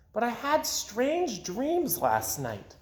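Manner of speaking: high, slow and dreamy; girl tells her mother of strange dreams, slow dreamy. A narrator's reading. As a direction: float the words out. Amy dreamy.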